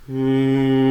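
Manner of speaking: a steady hum to give the pitch; sustained pitch-giving hum. Shown as a demonstration. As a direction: hold one pitch on a hum. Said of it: "I'll give you a pitch with my voice".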